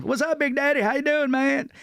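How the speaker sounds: high-pitched, hearty greeting in a friend's voice; Ralphie May. Retold or imitated. Comic imitates friend climbing into the car, high excited greeting. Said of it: "So he gets in the car".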